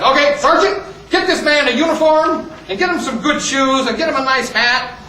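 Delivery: lightly acted commanding officer; commanding colonel. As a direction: issue brisk orders to a sergeant. Colonel gives orders to sergeant.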